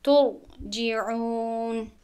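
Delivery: ترجعون drawn out very slowly; slow tajweed ترجعون; slow recitation. Shown as a demonstration.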